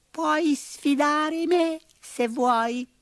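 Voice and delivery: very high, slow squeaky mouse; little mouse voice. A narrator's reading. Red mouse offering to challenge the bull, tiny high voice.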